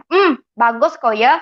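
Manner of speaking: put-on slow "bagus kok ya" in a light Pontianak Malay accent, "bagus" as "bagos"; sarcastic mother, lightly voiced in Pontianak accent. Mother's sarcastic chat read in a mild Pontianak accent. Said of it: "ini saya pakai logat Pontianak aja".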